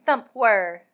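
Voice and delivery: ordinary delivery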